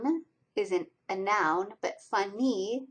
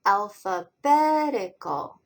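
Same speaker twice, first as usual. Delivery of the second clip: "alphabetical" slowly and louder, strongest on bet; slow, firm al-pha-BET-i-cal for "alphabetical"; stress on BET; 5 dB louder, slower than usual. Shown as a demonstration. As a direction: enunciate "alphabetical", lighter stress on al, heavy on bet. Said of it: "The strongest stress is betical".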